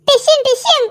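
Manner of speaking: playful fight noises then a high laugh; mock punch sounds. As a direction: punch the air with sound effects.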